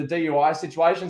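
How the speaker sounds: ordinary talk, nothing marked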